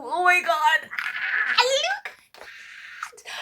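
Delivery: high, slow, laughing squeal; overwhelmed giddy wail; excited laughing cry. Real, not acted. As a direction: gasp it out in awe. Overwhelmed by tripod height.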